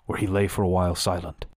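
ordinary delivery, nothing marked

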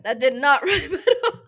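loud laughing admission; laughing at your own failed rhyme; cracking up. Real, not acted. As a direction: burst out laughing mid-sentence.

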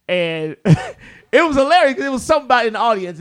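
normal talking voice

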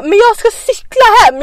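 high, loud, slow tipsy whine; drunk protest. Retold or imitated.

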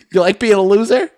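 high, loud teasing question; mock taunt. Friend taunting the gamer, high teasing voice. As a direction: rib him about losing. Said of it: "mock taunt".

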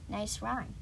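ordinary delivery

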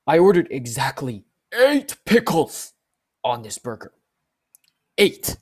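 loud, emphatic customer complaint, drawn out; mad customer. Retold or imitated. Customer demanding exact pickle count, acted angry. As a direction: hammer the count like an irate customer. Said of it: "This guy's mad".

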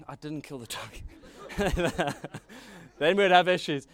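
real laughter through the words; giggling side comment, slow to start; laughing aside. Real, not acted. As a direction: chuckle your way through the joke. Laughing aside about the dog.

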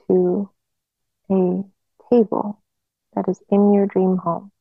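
normal talking voice

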